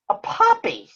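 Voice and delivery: high, excited Big Bird voice. Acted. Big Bird thrilled at Granny's surprise, high excited voice. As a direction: squeak it out like a big yellow bird.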